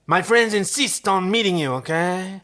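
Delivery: a hint of the Muppet king prawn. Kritz insisting to Chiana, lightly voiced as a prawn. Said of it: "Kritz from now on".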